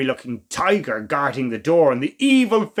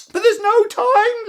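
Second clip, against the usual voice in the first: high, slow crying shout; frantic shout; 4 dB louder than usual. Acted. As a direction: wail it in despair. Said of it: "Lily shouted".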